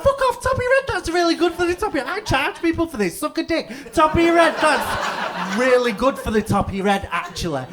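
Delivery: high-pitched, loud, indignant rant; hammed-up outrage, shouted very high; fuming. Comedian raging at an audience that won't laugh, fuming. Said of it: "And I was fuming".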